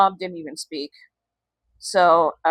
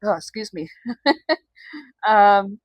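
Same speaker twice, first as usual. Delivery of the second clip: teary. Real, not acted. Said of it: "getting a little teary-eyed".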